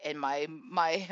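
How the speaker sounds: normal talking voice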